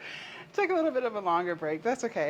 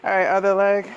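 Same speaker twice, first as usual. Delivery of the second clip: loud; 10 dB louder than usual. Real, not acted. Loud call right after the set.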